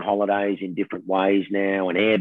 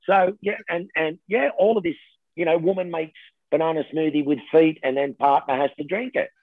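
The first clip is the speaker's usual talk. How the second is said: normal talking voice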